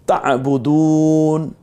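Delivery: তা'বুদুন very slowly, দু held long. Shown as a demonstration. Quran teacher demonstrating the long vowel to students. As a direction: draw out দু in তা'বুদুন. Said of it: "ওয়াও সাকিন একারিপ টেনেছি".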